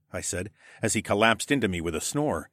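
ordinary delivery

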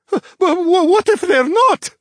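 very high, loud, panicky stammer; a scared child close to tears; tearful worry. A narrator's reading. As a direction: blurt it out, high and distressed. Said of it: "eyes welled with tears".

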